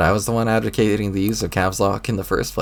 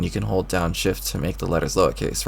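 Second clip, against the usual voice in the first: normal talking voice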